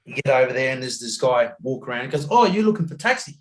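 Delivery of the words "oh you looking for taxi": high, eager mock taxi-tout voice. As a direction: imitate a tout offering a ride, pitched up. Retold taxi tout approaching him.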